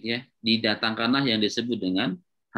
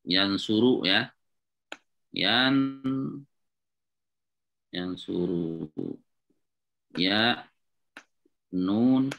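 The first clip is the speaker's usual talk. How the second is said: "yansuru" said slowly while writing; slow dictation; much slower than usual. Shown as a demonstration. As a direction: draw out "yansuru" for students to copy. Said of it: "kita tulis lagi".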